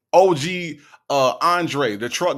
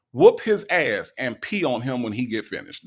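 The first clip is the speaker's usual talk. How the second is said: ordinary talk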